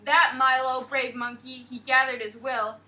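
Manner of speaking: put-on reading aloud to children; teacher reads the story to her class, lively storybook voice. A narrator's reading. Lively read-aloud narration.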